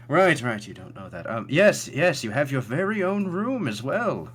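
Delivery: high, loud, flustered host; Thatch. Acted. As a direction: reassure a guest in a lifted character voice. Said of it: "He goes".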